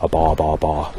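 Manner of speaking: mock a crappy modern gangster accent, bringing the R out; put-on modern gangster accent, R brought out. Shown as a demonstration. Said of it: "accent where they sort of bringing that R out".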